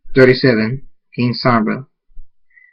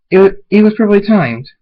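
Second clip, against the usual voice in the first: fast, higher, louder retort; raised-voice objection; defensive protest; 8 semitones higher, 5 dB louder, faster than usual. Acted. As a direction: insist indignantly. Character argues back.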